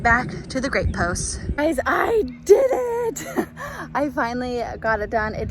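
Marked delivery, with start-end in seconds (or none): 1.6-3.3 high, slow, joyful shout of victory; triumphant cry. Real, not acted. Finally finished the trellis. 3.3-3.9 bright burst of relieved laughter; happy high laugh. Real, not acted. After finishing the trellis.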